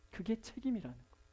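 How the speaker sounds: normal talking voice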